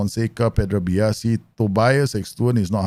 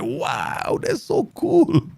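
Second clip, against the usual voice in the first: much higher, slow gushing wow; excited wow; much slower than usual. Retold or imitated. City boy hearing of a crocodile in a flooded house, excited. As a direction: gush like a kid hearing wild news. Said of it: "I get excited".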